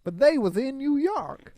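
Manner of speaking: high, loud southern US accent; hammed-up Mississippi voice. Shown as a demonstration. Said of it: "That sounds like Mississippi".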